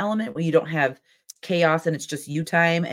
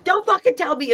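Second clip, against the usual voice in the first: high, slightly louder fake outburst; mock angry retort; 4 dB louder than usual. Friend playing Sam's rage at being told, high mock shout. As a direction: play Sam snapping back.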